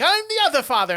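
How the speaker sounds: high, exasperated dad; mock insistent. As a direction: act out the second dad insisting. Retold argument over fathers.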